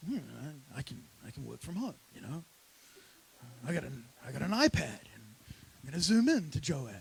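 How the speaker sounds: casual half-hearted excuse, tossed off under the breath; slacking off. Retold or imitated. Lazy David says he can work from home, slacking off.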